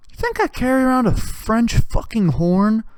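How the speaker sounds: ordinary delivery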